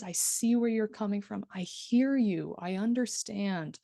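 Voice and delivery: slowing, weight on "see", "hear" and "understand"; emphasis on sense words. Shown as a demonstration. Instructor demonstrating genuine listening, leaning on "see", "hear", "understand". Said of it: "So really emphasize the senses".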